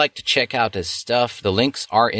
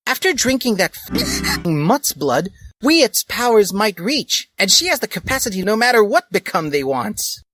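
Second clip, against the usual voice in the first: much higher excited plotting voice; high girlish character voice; 12 semitones higher than usual. Acted. Dali explaining the plan to Lupin, a high eager voice. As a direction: rattle off the evil plan eagerly.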